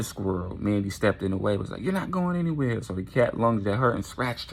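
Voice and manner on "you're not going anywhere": high-pitched, firm block; defiant warning. Acted. Mandy steps in the cat's way, high defiant warning. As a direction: stop it in a raised pitch.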